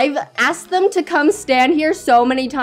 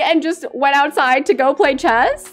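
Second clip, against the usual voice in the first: ordinary talk, nothing marked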